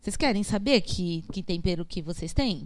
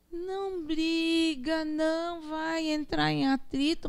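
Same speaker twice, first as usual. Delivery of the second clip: slow and calm; 7 semitones higher, slower than usual. Shown as a demonstration. Describing calm water-type people.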